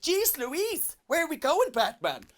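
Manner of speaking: put-on high, eager old-time sidekick; 1950s Robin. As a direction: pipe up like a gee-whiz boy sidekick. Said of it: "like nineteen fifties Robin".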